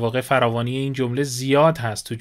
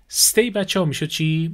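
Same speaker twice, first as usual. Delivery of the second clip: ordinary talk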